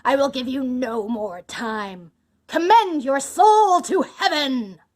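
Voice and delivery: loud command building to a slow shout; enraged executioner's roar; cried in anger. A narrator's reading. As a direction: bellow the final sentence. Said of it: "cried Bluebeard".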